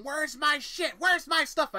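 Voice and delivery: high, loud frantic yelling; frantic shrieking demand. Retold or imitated. Roommate storming the halls for her things, high frantic yell. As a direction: shriek it like she's tearing the place apart. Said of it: "running up and down the halls going".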